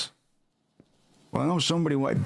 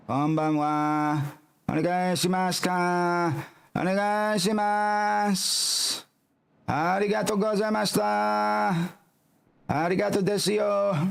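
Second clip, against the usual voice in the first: higher, slow, exaggerated polite Japanese; Japanese server; 6 semitones higher, slower than usual. Cook greets customers in a Japanese burger shop, hammed-up polite server. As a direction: sing out the greetings like an overeager clerk.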